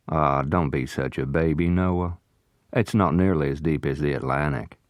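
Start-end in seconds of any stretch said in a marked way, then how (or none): none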